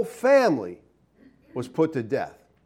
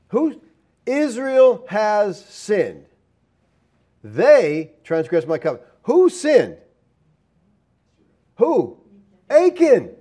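emphatic questioning; 5 semitones higher, slower than usual. Real, not acted. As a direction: press the crowd for the name. Preacher quizzing congregation emphatically.

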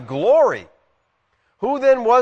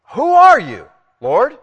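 startled cry; 6 semitones higher, 7 dB louder, slower than usual. Retold or imitated. Paul's cry to the voice.

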